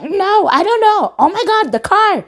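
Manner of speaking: high, loud frantic reply; panicked. Retold or imitated. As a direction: wail in alarm to a friend. Panicking on phone about car.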